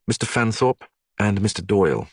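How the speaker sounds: plain reading voice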